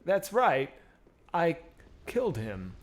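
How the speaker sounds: halting attempt at a celebrity voice; a half-hearted Walken, broken by odd pauses; Christopher Walken. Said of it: "I, I suck at it".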